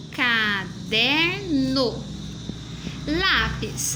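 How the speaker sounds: Caderno stretched very slowly, syllable by syllable; slow syllable reading. Shown as a demonstration. Teacher modelling a child's slow reading of the word. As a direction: drag Caderno out like a child decoding. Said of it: "fez a leitura da seguinte forma".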